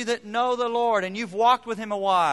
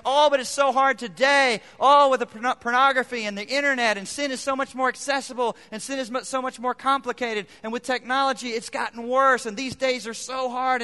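higher, louder whining complaint; whiny complaining; 5 semitones higher, 4 dB louder than usual. Retold or imitated. Husband complaining to his wife, hammed-up whine. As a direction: whine it like a sorry-for-yourself husband. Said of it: "whining and complaining to my wife".

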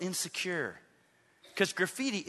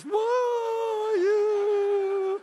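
imitating tearful outburst; 8 dB louder than usual. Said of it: "she just burst into tears".

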